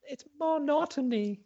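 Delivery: high, squeaky character voice; high caller voice. Acted. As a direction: play a silly caller. Plays caller Monotony.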